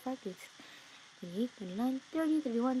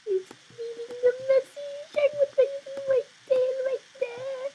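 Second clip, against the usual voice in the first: squealing eagerness; 15 dB louder than usual. Real, not acted. Hoping the trader takes her offer, high excited squeal.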